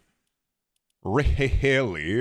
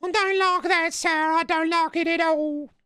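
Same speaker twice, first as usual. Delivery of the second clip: nasally British streamer. Acted. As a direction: complain as a hammed-up British Fortnite streamer. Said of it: "nasally British Fortnite streamer".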